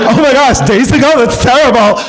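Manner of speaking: mock shock. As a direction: act horrified at the news. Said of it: "I was like".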